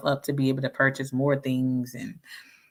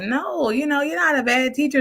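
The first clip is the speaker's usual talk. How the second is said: mock eager reassurance; 11 semitones higher than usual. Her younger self reassuring.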